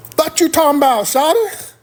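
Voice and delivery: the local squinting at the paper; Atlanta local voice. Retold or imitated. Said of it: "learn Atlanta slang on the fly".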